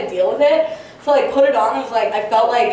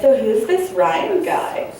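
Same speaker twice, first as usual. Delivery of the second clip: lightly voiced curious mom, pitched up; prying mom; 6 semitones higher, much slower than usual. Mom questions her child about a mystery date, lightly voiced, prying. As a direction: draw out the question like a nosy mother. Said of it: "my mom was, like, texting me".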